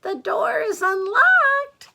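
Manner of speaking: very high, loud fake-granny falsetto; exaggerated, drawn-out high call; wolf posing as Granny. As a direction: call sweetly and slowly in a put-on old lady voice. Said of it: "said the wolf".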